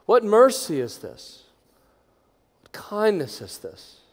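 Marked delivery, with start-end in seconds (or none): none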